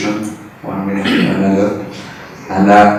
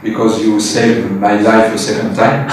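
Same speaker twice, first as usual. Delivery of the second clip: usual speaking voice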